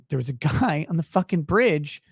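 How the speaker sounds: normal talking voice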